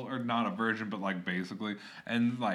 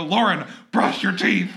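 louder, slow, laughing command; mock order; 6 semitones higher, 10 dB louder than usual. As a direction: bark it at Lauren jokingly. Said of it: "I literally just wanna go".

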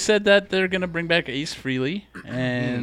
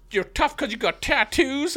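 mocking tough guy; 13 semitones higher, 4 dB louder, slower than usual. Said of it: "he's like".

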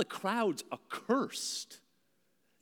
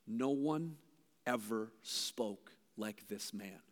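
soft, slow, lower and awestruck; awed hush; 9 semitones lower, 9 dB quieter, slower than usual. Retold or imitated. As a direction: let the words fall quietly, in wonder. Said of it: "I love what they respond".

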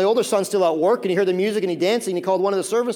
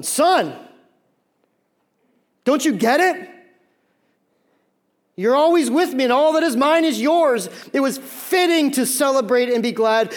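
loud, high, emphatic plea to a son; impassioned fatherly appeal; 6 semitones higher, 6 dB louder, slower than usual. Acted. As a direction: urge him earnestly to understand, stretching the last words. Father acted, appealing to his son.

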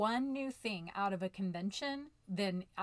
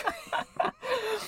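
high bright burst of real laughter; 5 dB louder than usual. Real, not acted. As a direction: laugh out at her own confession. Laughs at herself.

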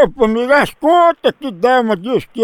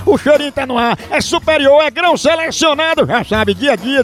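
upbeat radio host reading a live coffee ad; punchy radio announcer pitching coffee; 3 semitones higher, faster than usual. Acted. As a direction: sell it like a lively radio spot.

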